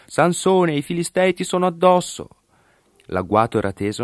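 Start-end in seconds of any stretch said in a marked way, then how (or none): 0.1-2.3 calling out high, sharp warning; urgent high shout of alarm; high alarmed cry. A narrator's reading. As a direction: cry out to wake him, pitched way up. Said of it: "lo legò e gli gridò".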